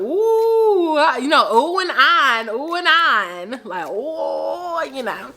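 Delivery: teasing oohs from the table; mock teasing ooh. Friends teasing a jealous boyfriend, mocking ooh. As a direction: tease him with drawn-out oohs.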